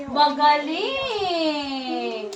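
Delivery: long, slow, stretched-out praise; slow sing-song "Magaling" for a pupil; drawn-out praise. Real, not acted. Teacher praising a pupil's answer.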